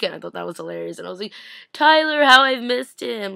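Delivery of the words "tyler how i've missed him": higher, louder, slow swooning gush. Pretend. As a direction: swoon over him out loud. Gushing over a returning character.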